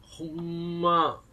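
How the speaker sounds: very slow drawn-out Kansai ほんま; mock Kansai. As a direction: stretch ほんま in fake Kansai. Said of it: "ほんまって言わない".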